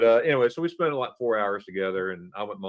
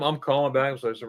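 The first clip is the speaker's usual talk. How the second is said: ordinary talk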